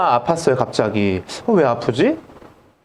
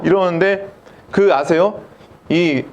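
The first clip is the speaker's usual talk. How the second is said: ordinary talk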